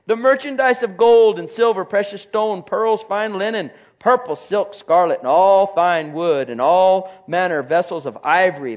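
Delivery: reading Revelation out loud with force; scripture reading. Acted. Preacher reading scripture to the congregation, loud. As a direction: proclaim the verses from the pulpit.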